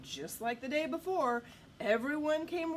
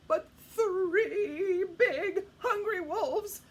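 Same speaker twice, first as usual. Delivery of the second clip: high, loud, urgent narration; excited storytelling; 8 semitones higher, 9 dB louder than usual. A narrator's reading. Storyteller telling children the wolves attack, excited and urgent. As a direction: raise pitch and volume as danger breaks out.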